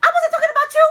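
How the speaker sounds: high, fast, embarrassed backpedal; flustered denial. Retold or imitated. Caught gossipers scrambling to deny, high and hurried. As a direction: blurt it out nervously, way up high. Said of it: "they'll be like".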